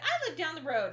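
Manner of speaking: high, loud imitation of a kid; excited child. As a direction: announce it like an eager little neighbor. Acting out neighbor child talking.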